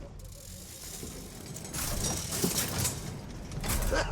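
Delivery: long, airless wheezing cackle; breathy cackle. Acted. Joker cackling over the trapped thug. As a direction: let a soft cackle trail out.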